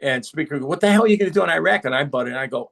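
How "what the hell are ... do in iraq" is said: retold incredulous question, quick and higher; lightly acted startled demand, rushed; incredulous challenge. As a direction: fire it off in mock disbelief. Acting the startled Speaker's challenge.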